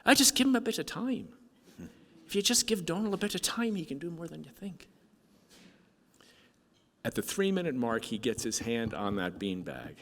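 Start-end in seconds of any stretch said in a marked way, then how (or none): 0.1-4.9 high-pitched, earnest voice of a dad; pleading father. Retold or imitated. Father telling the doctor to allow time, high earnest plea. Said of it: "I would always say to the doctor".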